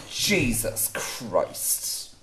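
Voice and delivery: slow, breathless whisper; exhausted whisper. Acted. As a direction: let it out like you're spent. Spent, muttering after the pain.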